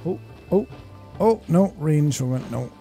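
ordinary delivery

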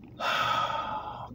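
slow breathy exhale; long sigh. Retold or imitated. Man showing his calming mantra routine, long sigh. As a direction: let out a long, audible breath. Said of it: "I'm like, I'm like".

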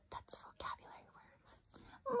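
high sharp gasp then whisper; gasp, then whisper the aside. Real, not acted. Teacher gasping, then whispering to the child.

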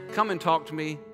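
usual speaking voice

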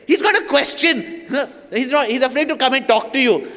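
mock girlish teasing; high teasing voice. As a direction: tease him in a high bright voice. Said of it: "she would say".

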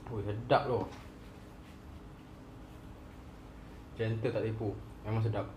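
talking with mouth partly full; pausing to wipe mouth; chewing food. Real, not acted. Seen 0: Holds chicken wing, cheeks working between bites, wipes mouth mid-phrase.

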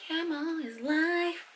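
high, soft, drawn-out echo; playful catchphrase echo. Pretend. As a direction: stretch it out slowly, high and soft. Catchphrase repeated for comic effect.

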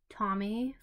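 usual speaking voice

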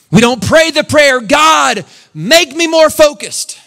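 high, loud preacher's cry; shouting sermon pitched high; full-force preaching. Acted. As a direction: preach it out to the rafters.

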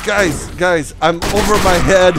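loud, high panicked cry for help; panicked shout. Real, not acted. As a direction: call out in panic. Overwhelmed by enemies.

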